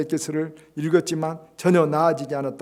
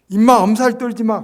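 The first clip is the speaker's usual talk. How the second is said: loud, higher dismissive scolding; scornful rebuke; 8 semitones higher, 13 dB louder than usual. Retold or imitated. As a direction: snap at the complainer. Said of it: "이렇게 말합니까?".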